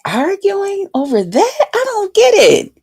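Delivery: high, softened incredulous questioning; retold disbelief. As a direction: replay being baffled, high and small. Retells her disbelief at the argument.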